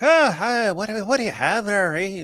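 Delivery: high put-on Irish lilt; lightly mocked Irish brogue; imitated Irish accent. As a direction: greet like a diner waitress in Yonkers. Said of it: "and they're like".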